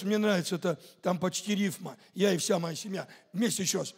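ordinary talk

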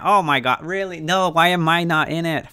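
sudden high-pitched disbelief; surprised. Real, not acted. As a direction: caught off guard and frustrated. Price jumps while not invested.

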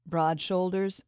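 ordinary delivery

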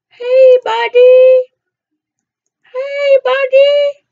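loud, high, drawn-out taunt; loud taunting call. Pretend. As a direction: call out mockingly. Taunting enemy player, louder.

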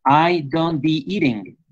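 slow, deliberately wrong "I don't be eating"; slow wrong example. Shown as a demonstration. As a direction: spell out the wrong sentence slowly. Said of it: "se complicaría en inglés".

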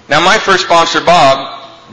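ordinary talk, nothing marked

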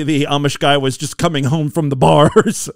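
ordinary talk

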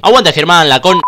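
loud cheer for the fighter; real excited ringside shout. Real, not acted. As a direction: yell it out at the screen.

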